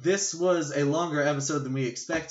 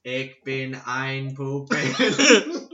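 very slow, much louder mock oration; mock JFK speech; 10 dB louder, much slower than usual. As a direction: drag each word out like a grand public address.

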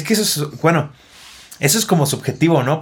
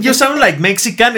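slow, higher imitation of English speakers; mock quote; 6 semitones higher, slower than usual. Imitating coworkers judging a Mexican's English, mock quote. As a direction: draw it out higher, as a teasing imitation.

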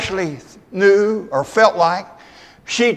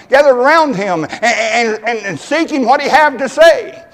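raised, louder preaching voice; fervent preaching; 6 semitones higher, 7 dB louder than usual. Acted. Preacher rousing the church about Zacchaeus, fervent and loud. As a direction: preach it out to the congregation.

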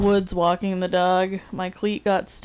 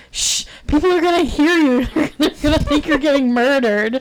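high, louder mock-alarmed mom, laughing; hushing mom; 7 semitones higher, 4 dB louder than usual. Mother hushing her yelling child in the woods, high and laughing. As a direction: scold through giggles like a mortified mother. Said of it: "my mom was just like".

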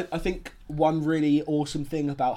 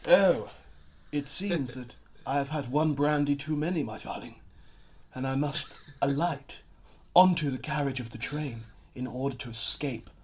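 put-on old RP accent. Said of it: "their acting is it's always very mysterious".

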